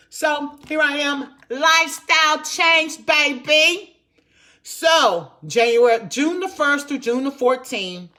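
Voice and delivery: loud, proud announcement of a new life; excited. Real, not acted. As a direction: shout it out like a victory. Proudly announces her lifestyle change.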